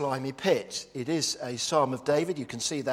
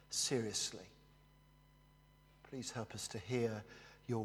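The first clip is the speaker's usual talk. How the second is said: hushed prayer; 2 semitones lower, 5 dB quieter than usual. Acted. Preacher praying for the congregation, soft and reverent. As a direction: pray quietly and humbly before the sermon.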